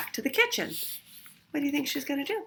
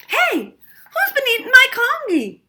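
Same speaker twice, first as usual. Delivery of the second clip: much higher, loud outraged demand; 10 dB louder than usual. A narrator's reading. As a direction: stress on my, protesting loudly. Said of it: "demanded Mister Chan".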